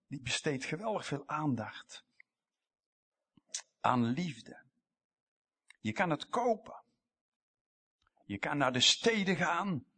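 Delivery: ordinary talk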